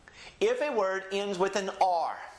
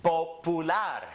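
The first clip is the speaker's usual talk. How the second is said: popular as po-pu-LAR, pure vowels; Spanish end stress; slower than usual. Shown as a demonstration. Teacher demonstrates a word ending in R, popular stressed on LAR. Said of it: "we're gonna go up on the N".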